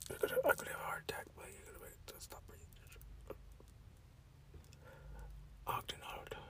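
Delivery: barely audible mock-panic whisper; panicked whisper. Acted panic, whispering to himself.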